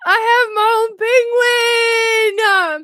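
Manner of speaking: high, loud, drawn-out cry of joy; overjoyed high shout; thrilled squeal. Real, not acted. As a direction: squeal it slowly with delight. Thrilled by penguin gift.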